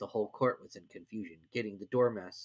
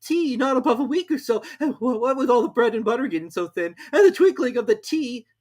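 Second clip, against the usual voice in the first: high, near-tearful babble; high, loud tearful Hatter; trembling Hatter; 13 semitones higher, 7 dB louder than usual. A narrator's reading. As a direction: stammer it out, frightened. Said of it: "in a trembling voice".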